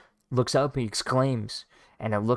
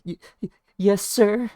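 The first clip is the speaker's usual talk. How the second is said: high shaky-stuttered yes sir; stammering fear; 10 semitones higher, 3 dB louder than usual. Retold or imitated. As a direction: cower and stutter. Bandit interrogated by swordsman.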